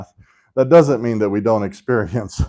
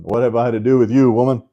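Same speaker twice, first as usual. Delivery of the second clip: louder, a touch higher and irritated; 4 semitones higher, 6 dB louder than usual. Shown as a demonstration. Teacher voicing Jesus's irritation with his mother, louder. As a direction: let the irritation show. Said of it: "I may be overinterpreting the irritation".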